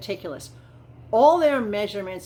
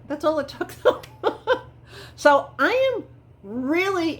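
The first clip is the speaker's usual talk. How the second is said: laughing, much higher pitch; 8 semitones higher, 3 dB louder than usual. Real, not acted. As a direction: giggle through the words. Laughs at how fast it went.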